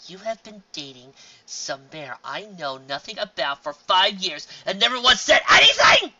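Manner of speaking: slow, building into a loud outraged shout. Acted. Sister accusing her brother of hiding the mare, outraged. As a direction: start slow then explode in fury. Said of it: "went from annoyed to outraged".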